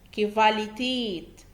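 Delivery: كفاليتيت said slowly and clearly as kivalitet; slow model word. Shown as a demonstration. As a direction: drill كفاليتيت slowly for the class to repeat. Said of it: "حاول تكرر معايا". (نطق كفاليتيت ببطء للتكرار)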